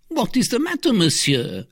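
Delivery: very high, anxious servant voice; concerned servant. Acted. Planchet to his pale master, high and concerned. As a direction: fret over your master in high pitch.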